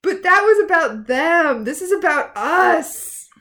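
high, loud melodramatic protest; hammed-up, insistent appeal; impassioned mock plea. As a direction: plead it theatrically, slowing on the last phrase. Hook's impassioned plea, acted out.

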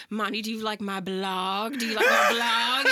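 hammed-up drunk friend begging for praise; drunk pleading imitation. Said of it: "I did not say blog like this".

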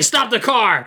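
imitated urgent cry, pitched high; mock alarm, high and sudden; horrified alarm. Retelling the guy's fear on seeing the cow, in mock alarm. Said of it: "horror and fear on the guy's face".

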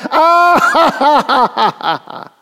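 high mocking cackle; evil chuckle. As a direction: laugh like a scornful villain. Mocking enemies' laugh.

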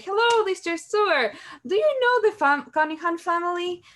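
dad phones the host family, mock-cheerful high voice; imitating dad. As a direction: play the eager dad calling a friend. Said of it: "He said".

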